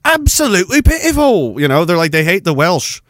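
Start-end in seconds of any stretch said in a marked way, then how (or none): 0.0-1.6 put-on haughty English accent, rising high on the scorn; exaggerated English disdain. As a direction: sneer in a mock English accent. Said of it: "other English people are like".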